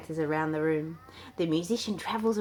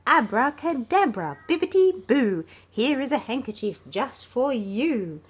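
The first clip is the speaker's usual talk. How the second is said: loud, higher magic chant; magician's rhyme; 7 semitones higher, 5 dB louder than usual. Acted. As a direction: cast the spell with flair. Simon as magician.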